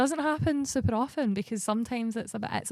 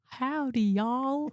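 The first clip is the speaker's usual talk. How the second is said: higher, hammed-up American drawl; badly faked Southern American accent; 5 semitones higher, much slower than usual. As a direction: attempt a cheesy American cowboy accent. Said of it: "[name], do an American accent".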